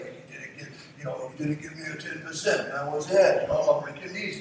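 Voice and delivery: low-key mafia enforcer with a mock Italian accent; soft, muttered Godfather-style Italian threat; bad Italian accent. Mobster collecting God's ten percent, bad Italian accent. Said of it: "that's a bad Italian accent".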